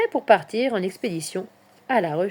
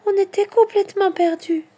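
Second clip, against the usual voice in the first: high, shaken mother's voice; trembling voice; 11 semitones higher, 5 dB louder than usual. A narrator's reading. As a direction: confess distress, pitched up and trembling. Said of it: "d'une voix tremblante". (voix aiguë et tremblante)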